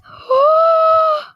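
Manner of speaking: huge, high, loud gasp; shocked gasp. Real, not acted. Streamer sees the trade result, shocked gasp.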